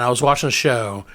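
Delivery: usual speaking voice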